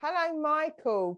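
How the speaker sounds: normal talking voice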